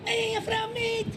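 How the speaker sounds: very high-pitched, threatening Scouser; exaggerated Scouse. Shown as a demonstration. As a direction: squeal out menacing Liverpool slang. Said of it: "So here's, I think, a Scouser".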